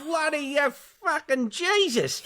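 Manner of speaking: put-on high, slow pained yelling of an old farmer; burned farmer's cry. Old farmer yelling at the kids after being burnt. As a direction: wail it like your face just got scalded. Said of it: "You just hear".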